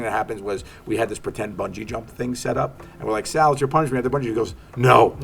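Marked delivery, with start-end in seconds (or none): 3.3-4.0 mock announcement. Crew announces fake punishment. 4.8-5.2 mock loud no. Retold protest at bungee.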